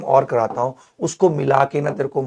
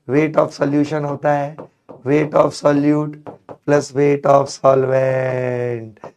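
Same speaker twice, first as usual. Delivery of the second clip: slow, spaced out teacher dictation; deliberate classroom recital; slow formula dictation; much slower than usual. Shown as a demonstration. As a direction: spell the formula out word by word. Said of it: "teacher spells out formula slowly".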